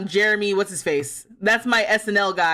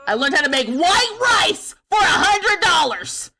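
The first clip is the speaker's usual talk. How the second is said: higher, louder, slow indignant yell; outraged shout; 8 semitones higher, 5 dB louder than usual. Real, not acted. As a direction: bellow it in disbelief. Outraged at paid rice recipe.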